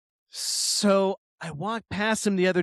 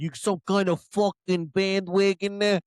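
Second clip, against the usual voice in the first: exaggerated East Coast accent impression; imitated East Coast accent. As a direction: mimic an East Coast guy's accent, accusing. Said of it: "literally says it like that".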